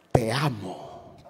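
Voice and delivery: soft, slow and tender te amo; a soft, heartfelt declaration of love. Retold or imitated. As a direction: breathe it out lovingly, slowly. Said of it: "La mujer quiere oír esa expresión".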